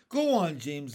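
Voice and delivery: ordinary delivery, nothing marked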